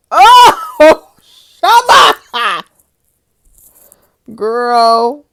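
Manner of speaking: high, loud laughing shout; joyful high yell; ecstatic shout. Real, not acted. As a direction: shriek with delight. Ecstatic reaction to journal.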